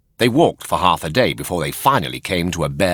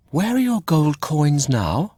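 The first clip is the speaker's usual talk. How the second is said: higher, probing fairy voice; curious fairy; 10 semitones higher than usual. Acted. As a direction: ask him straight. Fairy questions Pinocchio.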